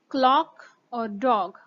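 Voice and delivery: "क्लॉक और डॉग" said slowly with a short open o; drawn out "क्लॉक और डॉग", short o carefully shown; short o words. Shown as a demonstration. Teacher models short o for learners, slow demo. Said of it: "इसी तरह ओ में".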